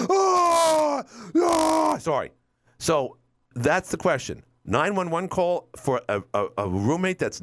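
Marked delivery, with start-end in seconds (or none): 0.1-2.0 sudden high-pitched scream of horror; acted scream. Acting out the roommate waking to blood, loud shrieked gasp.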